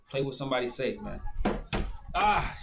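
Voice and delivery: ordinary talk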